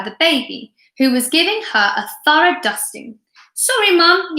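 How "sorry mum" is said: high loud called apology; a girl calling out to her mother; yell. Acted. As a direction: shout it across the room. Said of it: "yelled Laura".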